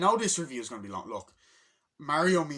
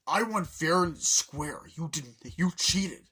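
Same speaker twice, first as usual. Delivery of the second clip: slow, loud, emphatic protest; put-on outrage, spelled out word by word; mock indignant accusation; 4 semitones higher, 6 dB louder, slower than usual. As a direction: argue like a sore loser. Acting Donkey Kong quarrelling with Mario.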